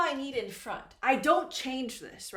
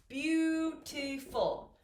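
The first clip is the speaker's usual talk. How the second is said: slow, higher, three stretched beats on "beautiful"; syllable by syllable; 3 semitones higher, much slower than usual. Shown as a demonstration. Teacher counting syllables for students, syllable by syllable. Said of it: "Three syllables".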